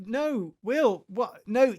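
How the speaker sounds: ordinary delivery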